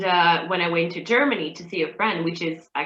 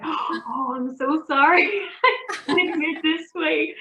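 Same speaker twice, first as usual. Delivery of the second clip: flustered apology; 8 semitones higher than usual. Retold or imitated. Apologizing to surprised boyfriend.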